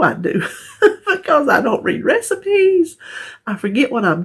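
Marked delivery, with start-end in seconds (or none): 0.8-3.0 high-pitched, laughing through the words; real laugh, voice riding up high; laughing confession. Real, not acted. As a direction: own up to it while breaking into laughter. Laughs at her own cooking habits.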